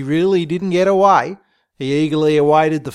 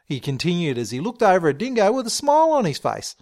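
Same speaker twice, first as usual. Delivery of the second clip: usual speaking voice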